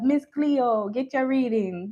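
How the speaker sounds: mock Jamaican psychic hotline voice, badly done; terrible Jamaican accent. Mocking the TV psychic ads we grew up with, in a bad Jamaican accent. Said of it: "that was a terrible Jamaican accent".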